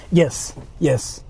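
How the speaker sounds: normal talking voice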